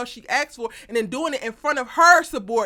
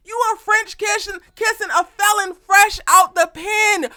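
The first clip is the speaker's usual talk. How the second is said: loud, much higher, slow shouted scolding; disbelieving shout; 8 semitones higher, 7 dB louder, slower than usual. Acted. Recapper shouting at Sabrina on screen, slow and loud.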